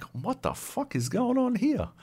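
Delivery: high-pitched bewildered disbelief; incredulous reaction. Retold or imitated. Viewer reacting to a surprise sex scene in Highlander, incredulous. Retells his shocked reaction to film.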